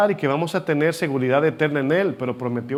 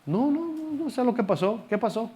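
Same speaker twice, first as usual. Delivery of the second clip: much higher, soft mock-innocent voice; playing clueless; 7 semitones higher, 5 dB quieter than usual. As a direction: act the stranger who has no idea what happened. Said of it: "Jesús se está presentando como alguien despistado". (haciéndose el despistado, voz mucho más aguda y suave)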